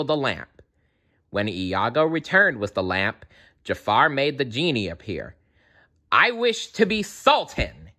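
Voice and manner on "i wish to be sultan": much higher, demanding villain voice. A narrator's reading. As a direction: Jafar greedily commands the genie. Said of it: "he demanded".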